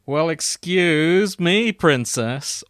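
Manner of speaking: loud, drawn-out exasperated "excuse me"; hammed-up Brooklyn wisecrack; nineties Brooklyn man. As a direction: stretch it out, indignant Brooklyn guy. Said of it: "he was a nineties Brooklyn man going".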